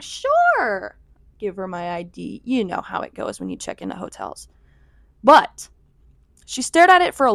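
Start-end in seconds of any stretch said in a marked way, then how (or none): 0.0-1.0 squeaky, too-high "sure" while nervous. Retold or imitated. To the hotel clerk studying her ID, a high nervous yelp. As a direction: jump way up in pitch, tense and loud.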